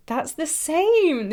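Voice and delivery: excited exclaim. Real, not acted. Stunned at matching lipstick shades.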